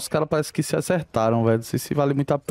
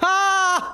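excited yell; 13 semitones higher than usual. Real, not acted. Team wiped, celebrating.

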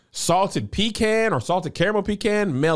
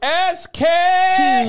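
squeaky high echo; high chime-in; 6 dB louder than usual. Acted. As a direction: chirp the letters up high.

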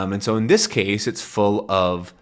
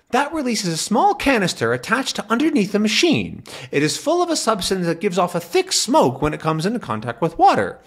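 put-on much higher, fussy old scientist; fussy professor; 11 semitones higher than usual. Calculus explaining the red button to Tintin, high professor voice. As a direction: explain the gadget eagerly.

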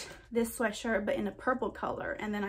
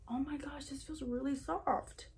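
real change in voice, much softer; quiet, altered voice; changed voice; 8 dB quieter than usual. Real, not acted. Vlogger feeling the soft fabric, voice all changed. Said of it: "My voice all changed".

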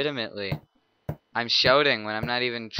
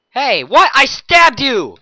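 yell; 13 semitones higher, 14 dB louder, slower than usual. Acted. Killer yelling at fleeing victim.

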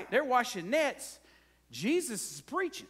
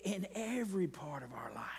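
whisper; 5 semitones lower, 6 dB quieter than usual. Real, not acted. Drops to a hush after laughing.

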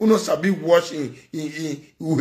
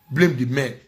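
ordinary talk, nothing marked

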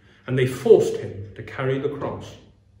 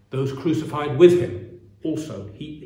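ordinary talk

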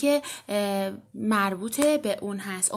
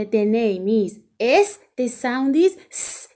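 slow teaching; 5 dB louder, much slower than usual. Shown as a demonstration.

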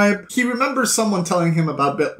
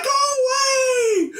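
mock yell; much faster than usual. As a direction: imitate someone shooing creatures away. Tim chases off climbing creatures.

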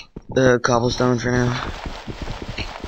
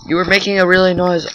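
loud complaint; 5 semitones higher, 5 dB louder than usual. Real, not acted. Complaining to a friend.

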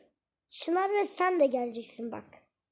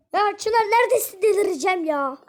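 exasperated shout; 4 semitones higher, 7 dB louder than usual. Real, not acted. Calling missing friend in game.

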